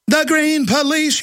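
high, drawn-out sing-song; mock green police. Host mimicking the ad's green police, high sing-song. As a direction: chant it high and slow, mockingly.

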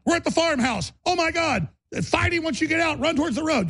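high frantic backseat commands; frantic urging. Retold or imitated. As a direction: yell directions like the car's about to crash. Panicked self urging escape.

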